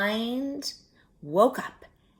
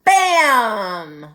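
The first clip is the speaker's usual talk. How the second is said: a sudden loud shouted bam; loud bang; 3 semitones higher, 14 dB louder than usual. A narrator's reading. Narrator startles the listener as the cookie leaps out, loud. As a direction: burst out like the oven door flying open.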